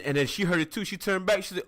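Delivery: ordinary talk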